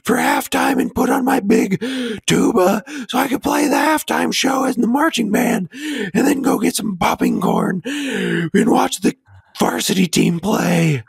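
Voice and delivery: squeaky, very high falsetto; hammed-up high little-kid voice; mock younger self. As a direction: gush like an over-eager kid, way up in falsetto. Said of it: "Back then".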